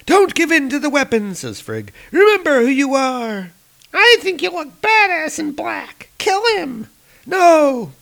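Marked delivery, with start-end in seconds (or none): 0.0-1.3 high pleading woman's voice. Acted. Comic character voiced. 2.1-7.9 high, taunting woman's voice; tempting woman. Acted. Comic character voices.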